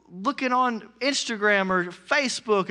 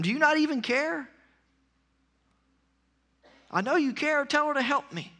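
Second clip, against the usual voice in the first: a touch of a pleading Martha, higher; lightly pleading, pitched up; 8 semitones higher than usual. Acted. Martha asking Jesus to make her sister help, mildly pleading. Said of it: "She asked him".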